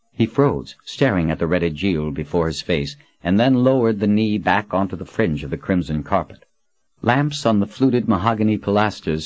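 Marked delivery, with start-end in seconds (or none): none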